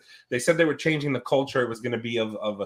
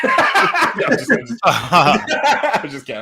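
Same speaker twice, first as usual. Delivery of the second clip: laughing through the words; 6 semitones higher, 7 dB louder, much slower than usual. Real, not acted. Laughing at his own prank on the guys. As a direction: giggle it out after the joke.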